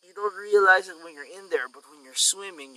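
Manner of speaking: usual speaking voice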